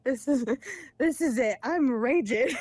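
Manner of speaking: retold rage. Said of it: "that pissed me off so bad".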